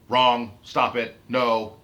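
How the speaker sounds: loud, emphatic shutdown; forceful rebuke. Acted. Admins standing up to mind games, forceful rebuke. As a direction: cut the objection off loudly.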